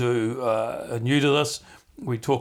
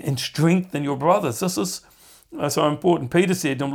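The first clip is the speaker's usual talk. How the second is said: usual speaking voice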